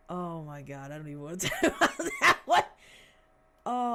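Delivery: sigh, then a loud, fast burst of laughing words. Real, not acted. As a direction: laugh through the embarrassment. Embarrassed at chat comment.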